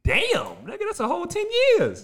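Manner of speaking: high, loud disbelief; shocked kid. Retold or imitated. Boy told he'd have waited ten years, high loud disbelief. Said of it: "I said".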